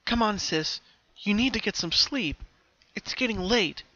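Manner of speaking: put-on older sister Octavia comforting the filly. A narrator's reading. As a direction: soothe a little sister to bed. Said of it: "her older sister, Octavia".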